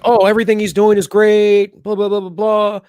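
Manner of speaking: high, loud, gushing media parody; mocking the fawning media, high and loud. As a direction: gush like a fawning reporter. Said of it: "They'll just be like".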